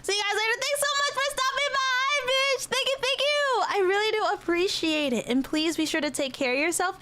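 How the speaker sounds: high-pitched cutesy thanks, easing down midway then rising; gushing farewell. Pretend. Streamer waving off departing viewers, gushing high. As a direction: squeal your thanks in a sweet little voice.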